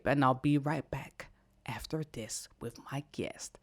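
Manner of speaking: soft, breathy-voiceless whisper; put-on whisper. Real, not acted. Host teasing the break before the guest, playful whisper. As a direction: whisper it like a secret sign-off. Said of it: "Why am I talking like this?".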